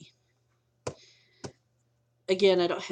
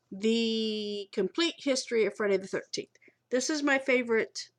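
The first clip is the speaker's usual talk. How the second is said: normal talking voice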